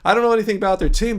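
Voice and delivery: ordinary delivery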